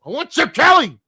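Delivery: high, very loud angry demand; real fan outrage at full volume; mad yelling. Real, not acted. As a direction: shout it out in frustration. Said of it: "how mad I am".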